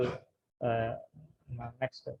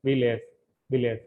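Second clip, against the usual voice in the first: Village louder, first syllable punched; stress on first syllable; 4 dB louder than usual. Shown as a demonstration. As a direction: hit the వి of village. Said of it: "first నుంచి కూడా మనం pronounce".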